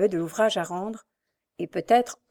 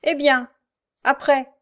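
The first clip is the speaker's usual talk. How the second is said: defiant retort; 6 semitones higher, 5 dB louder than usual. A narrator's reading. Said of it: "répliqua Jacques".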